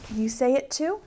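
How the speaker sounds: ordinary delivery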